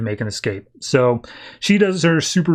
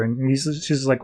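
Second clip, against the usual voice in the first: ordinary talk, nothing marked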